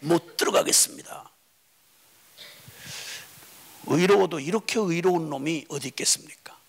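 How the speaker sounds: 낮은 속삭임으로 비꼬듯; 의로운 척을 비웃듯 속삭여; 빈정대는 속삭임. Acted. 형의 빈정거림을 속삭임. (형의 독선을 비꼬는 설교자의 속삭임)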